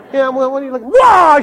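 mock startled cry. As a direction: catch sight of the boss and jump. Startled by boss entering.

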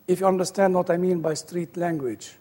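ordinary delivery

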